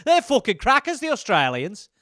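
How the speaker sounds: a light English voice; lightly done British accent; mock English accent. English viewer mocking Australians, light English accent. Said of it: "English people would be like".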